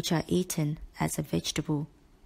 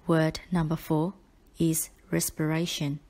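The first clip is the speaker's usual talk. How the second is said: usual speaking voice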